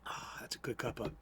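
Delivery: whispered after a sip of hot coffee; breathy aah after sipping coffee. Real, not acted. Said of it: "I'm about to taste it".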